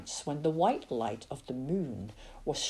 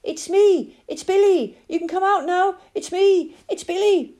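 much higher, louder hopeful calling; 7 dB louder than usual. A narrator's reading. Billy calling down into the fox den, loud and hopeful. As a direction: call down the hole to them. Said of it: "he could call down into the den".

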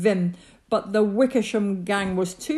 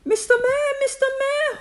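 high, urgent hail; insistent high-pitched summons; calling out; 4 dB louder than usual. A narrator's reading. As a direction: call up the mayor in a raised voice. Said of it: "Horton called".

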